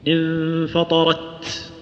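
tajweed demo of "انفطرت" with stopped, then breathy final ت; held, then released ت. Shown as a demonstration. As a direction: chant "انفطرت" very slowly, stopping the sound on ت before releasing breath. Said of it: "وتحبس الصوت ثم تجري النفس".

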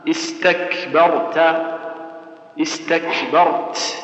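very slow استكبرت, K closed then released with breath; press, then aspirate. Shown as a demonstration. Teacher showing the correct way, press then aspirate. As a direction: stretch استكبرت, clamp K and final T, then puff. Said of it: "تشد ثم تهمس بهالشكل هذا".

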